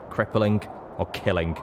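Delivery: ordinary delivery, nothing marked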